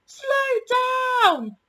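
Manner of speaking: high, loud panicked yell; passengers yelling. A narrator's reading. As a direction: cry out for the train to slow. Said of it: "yelled the passengers".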